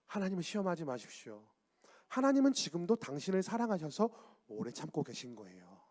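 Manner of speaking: soft, low, patient reply; calm a scoffer with quiet assurance; gentle admonition. Acted. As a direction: drop to a hushed, tender counsel. Models gentle answer to the mockers.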